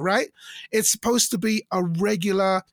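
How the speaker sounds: ordinary delivery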